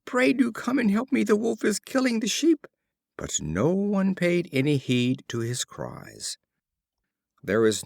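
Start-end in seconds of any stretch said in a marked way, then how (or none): 0.0-2.7 much higher, panicked plea; a boy shouting in terror; terrified shout. A narrator's reading. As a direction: scream for help in terror. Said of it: "shouted in an agony of terror".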